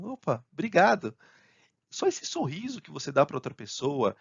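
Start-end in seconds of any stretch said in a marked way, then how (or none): 0.0-1.1 bright lift in pitch, louder, grateful; smiling thanks. Acted. Thanking a colleague who solved it, smiling, higher voice. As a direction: say thanks through a smile. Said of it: "aí você dá aquele sorriso".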